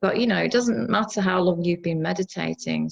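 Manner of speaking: normal talking voice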